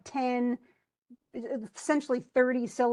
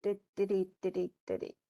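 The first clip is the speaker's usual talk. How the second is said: soft di-di beats tapping the meter; di-di rhythm; 4 semitones lower, 5 dB quieter, faster than usual. Shown as a demonstration. Teacher tapping out the line's rhythm in di syllables. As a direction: hum the beat in di syllables. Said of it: "Darkness there and nothing more".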